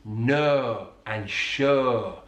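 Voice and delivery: slow, long "nur" and "shur" for no and show; drawn-out Yorkshire vowel in no, sure; Yorkshire elongated O. Shown as a demonstration. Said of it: "Very typical of a Yorkshire accent".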